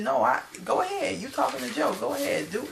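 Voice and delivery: high-pitched mock huffy retort; retold upset retort. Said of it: "that got Tahari upset".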